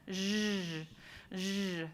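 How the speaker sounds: "zh" twice, each a long voiced buzz; sustained zh sound. Shown as a demonstration. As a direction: model a drawn-out ZH for a class. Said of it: "Everybody say that".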